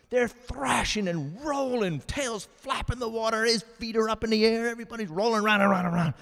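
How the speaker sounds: high, loud, breathless-paced excitement; frantic fight commentary. Acted. Joke teller acting out the gator wrestle, frantic. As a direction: build to a racing finish as the bodies roll.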